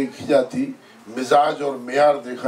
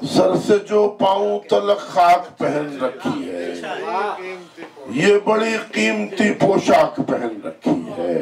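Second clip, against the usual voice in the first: raised, sustained declamation falling at each line's end; Urdu poetry recitation; 7 semitones higher, slower than usual. Acted. Poet repeating couplets to an appreciative mushaira, declaimed. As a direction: declaim each verse to the gathering, drawing out the rhyme.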